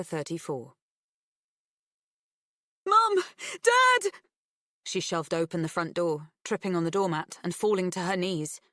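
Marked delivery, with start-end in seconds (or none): 2.9-4.1 high, loud, urgent call. A narrator's reading. Upset girl bursting in, calling her parents loudly. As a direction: cry out for help.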